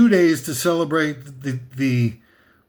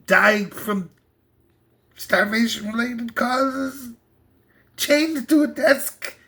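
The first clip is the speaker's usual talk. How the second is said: mock keynote pitch, climbing much higher toward the end; Steve Cook impression; 5 semitones higher than usual. Mocking an Apple CEO at a product launch, climbing much higher. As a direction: ham it up like a slick tech CEO selling a product, slowing as it builds. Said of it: "coming out like, going".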